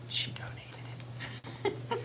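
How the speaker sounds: conspiratorial unvoiced whisper. Real, not acted. Host whispers her suspicion to the viewers about her mother. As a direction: whisper it as a slow secret.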